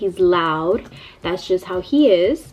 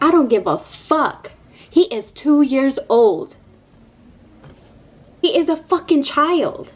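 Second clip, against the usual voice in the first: higher, emphatic indignation; retold angry rant; 5 semitones higher than usual. Mom re-enacting going off on the lady, angry. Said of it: "I was going off on this lady".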